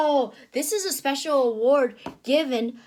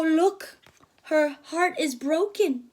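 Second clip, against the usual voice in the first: plain reading voice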